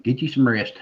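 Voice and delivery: ordinary talk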